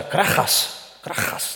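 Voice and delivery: voiceless, rasped "krachas"; croaking. Shown as a demonstration. Preacher imitates the father's cry in Greek, croaking. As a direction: croak the word out like its own meaning. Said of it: "Da schrie krächzend der Vater".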